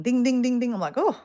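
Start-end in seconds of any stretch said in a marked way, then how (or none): 0.0-0.7 higher, louder little ding ding sounds; chirpy imitated notification dings; phone ding imitation. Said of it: "my phone starts dinging". 0.9-1.2 much higher, puzzled surprise; startled and puzzled at a buzzing phone; surprised. Retold or imitated. Phone suddenly full of messages.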